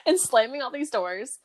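normal talking voice

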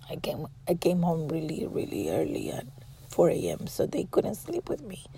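very soft, almost whispered; subdued confiding. Real, not acted. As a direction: drop to a hushed, intimate murmur. Subdued, confiding talk about her kids.

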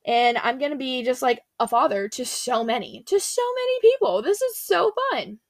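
gushing about becoming a father to many; excited Abram. Acted. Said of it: "So he's excited".

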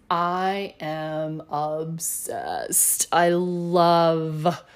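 very slow, drawn-out words; emphatic gushing. Real, not acted. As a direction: stretch every word out for emphasis. Gushing about true crime programs.

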